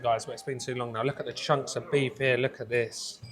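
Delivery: normal talking voice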